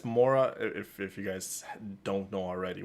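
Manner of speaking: ordinary talk, nothing marked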